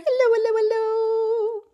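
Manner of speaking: high, rapid-fire hellos; as fast as possible. Shown as a demonstration. Greeting babies as fast as we can, rapid high voice. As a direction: rattle them off without a gap. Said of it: "as fast as we can".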